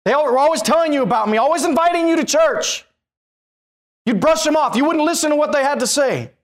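fiery sermon; preaching. Acted. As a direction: press the accusation hard. Preaching again.

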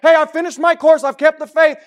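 shouted high and loud; triumphant proclamation. Acted. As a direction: cry it out to the whole congregation. Same quote proclaimed at full force.

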